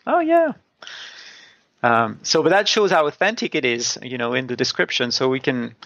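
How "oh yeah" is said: high, much higher "oh yeah" of sudden insight; mock aha moment, high; mock realization. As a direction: squeak it out like it just clicked. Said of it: "So I was".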